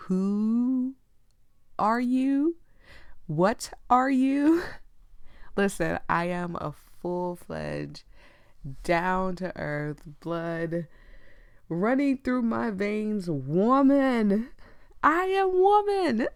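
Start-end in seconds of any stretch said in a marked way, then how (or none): 0.1-4.6 drawn-out, higher rising questions; mock puzzled interrogation, pitched up; incredulous questioning. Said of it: "I always get this question of". 11.7-16.3 slow, higher, playfully grand proclamation; exasperated, hammed-up insistence; emphatic declaration. Real, not acted. Mock-grand declaration of womanhood.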